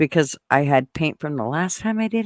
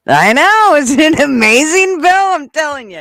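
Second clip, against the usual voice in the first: high, loud, laughing delight; thrilled; 10 semitones higher, 8 dB louder than usual. Real, not acted. Telling Bill about her painted lawn, loud and thrilled. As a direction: gush with real excitement.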